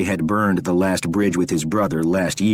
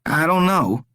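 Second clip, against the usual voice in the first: high, desperate begging; pleading captive Rory; 9 semitones higher than usual. A narrator's reading. Rory begs the stranger to spare him, desperate. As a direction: beg for your life, pitched up.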